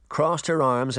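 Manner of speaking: usual speaking voice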